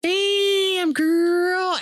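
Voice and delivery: very high, drawn-out cheer; admiring mirror hype. Retold or imitated. Admiring oneself in the mirror, high hyped-up call. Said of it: "I look at myself and I was like".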